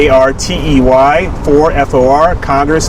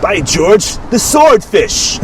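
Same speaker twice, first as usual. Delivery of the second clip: high, slow mock-posh punchline; a light stab at a posh Englishman; British punchline voice; 6 semitones higher, much slower than usual.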